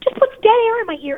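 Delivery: high-pitched exasperated complaint. Real, not acted. Complaining that the officer won't say why he's calling, exasperated. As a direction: voice climbing high in disbelief.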